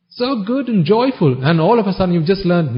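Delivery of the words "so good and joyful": mock naive wonder. Mocking audience's naive belief.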